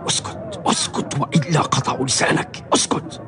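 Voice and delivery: hushed, urgent warning whisper. Acted. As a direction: whisper it so nobody hears. Friend hushes him in fear.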